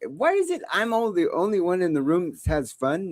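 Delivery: ordinary delivery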